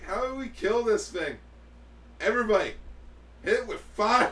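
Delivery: high, loud battle cry; rally the troops, shouting. Retold or imitated. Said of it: "it's like".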